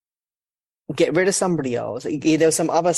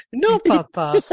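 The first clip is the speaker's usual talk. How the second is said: sing-song kiddie rhyme voice, climbing higher and louder into laughter; mock nursery rhyme; 8 semitones higher, 3 dB louder than usual. Mocking the YouTube kids' rhyme to his co-hosts, high and loud. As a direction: ham up the Johnny Johnny rhyme for kids.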